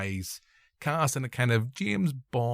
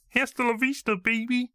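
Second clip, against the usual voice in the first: very high, hammed-up action-hero catchphrase; bad Arnie voice turning into Kermit; Arnold; 11 semitones higher than usual. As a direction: squeeze out a mock Schwarzenegger line. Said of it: "more I sound like Kermit the Frog".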